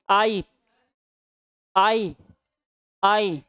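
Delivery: "आई" said alone, a pause after each; vowel pronunciation demo. Shown as a demonstration. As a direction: sound out "आई" as a lone, sustained vowel. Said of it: "आप उच्चारण करके देख लीजिए".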